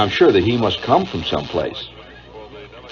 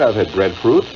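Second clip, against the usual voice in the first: usual speaking voice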